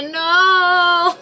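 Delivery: a high, sung, whiny wail of no, no, no; mock dismay. Told her friend is also crocheting Shrek, wailing high in mock dismay. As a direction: playfully refuse to hear it, sing-song and high.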